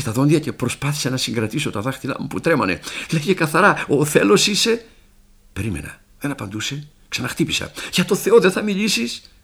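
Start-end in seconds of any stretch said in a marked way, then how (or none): 3.1-4.8 raised, high-pitched stammered demand; urgent questioning. Acted. Urgent demand to unseen prisoner. 7.9-9.3 urgent plea. Acted. Pleading with silent fellow prisoner.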